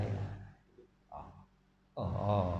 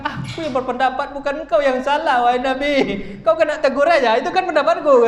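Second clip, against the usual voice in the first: high, loud, pleading objection; impassioned protest; 10 semitones higher, 7 dB louder than usual. Acted. Preacher as Abu Bakar telling the Prophet it was his opinion, impassioned protest. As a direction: argue it loudly at a raised pitch.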